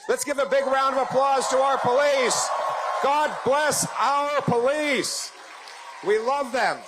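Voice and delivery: high, loud call for applause; rally shout. Real, not acted. Politician calling crowd to cheer police, loud and high.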